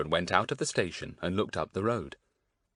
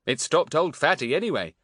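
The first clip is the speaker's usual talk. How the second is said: mildly pleased, raised voice; 6 semitones higher, 5 dB louder than usual. A narrator's reading. Larry defending his whistle to Daisy, pleased. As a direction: sound a touch pleased with yourself.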